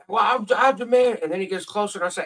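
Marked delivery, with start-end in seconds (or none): 0.1-1.2 high, slow, pompous shouted demand; officious demand. Retold or imitated. Drunk neighbor confronting the narrator in the fog, pompous high shout. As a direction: puff yourself up like a self-appointed lawman.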